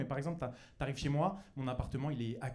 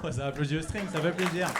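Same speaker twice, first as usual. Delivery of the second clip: usual speaking voice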